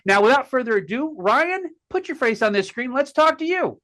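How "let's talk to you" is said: normal talking voice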